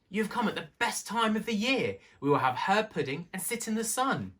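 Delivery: high, eager little mouse voice; a country mouse proudly welcoming a friend; cheerful little mouse. A narrator's reading. Timmy welcoming his guest.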